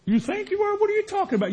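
high, disbelieving squeak of a question; a woman who can't believe he isn't sure he's married; incredulous. Acted. Old girlfriend to Big Mo, high incredulous mock squeak.

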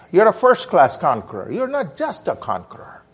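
ordinary delivery

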